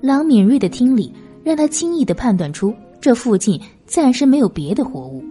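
usual speaking voice

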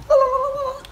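exaggerated turkey call, way up high; turkey gobble. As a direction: go full turkey. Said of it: "like a turkey".

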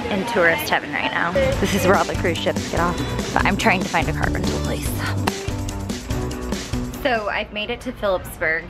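ordinary talk, nothing marked

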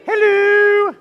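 very high loud sing-song hello; high hello for Mary. Shown as a demonstration. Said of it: "we say".

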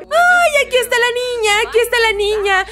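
high, loud delighted cry; thrilled shriek; excited squeal. Real, not acted. Baby appears in family game.

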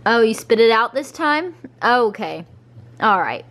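usual speaking voice

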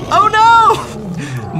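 high, loud panicked cry; alarmed cry. Acted. Narrator as the tigers wake, a loud panicked cry.

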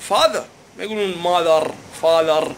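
"father" said the American way, no final r. Shown as a demonstration. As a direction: drop the r at the end of "father". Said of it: "مثل كلمة mother".

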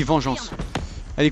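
ordinary talk, nothing marked

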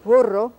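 emphatic Spanish "Burro" with trill. Shown as a demonstration. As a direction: roll the R and loosen the B in "Burro". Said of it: "not really a B like in English".

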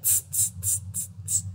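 soft voiceless tss tss tss; tut-tut like a cheeky monkey; monkey tsk. Acted. Monkeys tutting back at the peddler, soft voiceless tss. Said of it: "they said".

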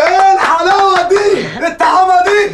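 very high, slow delighted squeal; over-the-top high delight; gushing. Acted. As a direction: coo over it in mock rapture. Gushes over flowery greeting.

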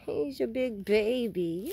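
ordinary delivery

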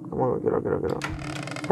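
hushed, unvoiced pleading; scared whisper. Real, not acted. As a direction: whisper so it can't hear. Hiding from monster.

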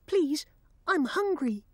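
high begging bird voice; pleading blackbird. Acted. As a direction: plead like a hungry little bird. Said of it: "said the blackbird".

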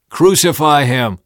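loud, high crowd cry; shouting back. A narrator's reading. Crowd answering Pilate, shouted back. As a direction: shout the demand. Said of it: "They shouted back".

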